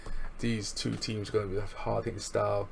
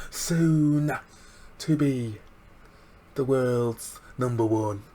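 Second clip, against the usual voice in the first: strut it like a cocky heel; imitating Zach Gibson; 3 semitones higher, 5 dB louder, much slower than usual. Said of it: "Zach Gibson goes".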